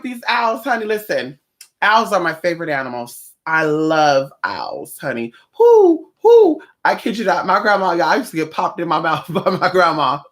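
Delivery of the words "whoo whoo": high, loud hooting like an owl; playful owl call, whoo whoo. Pretend. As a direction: hoot it out like an owl. Praising owls, imitates their call.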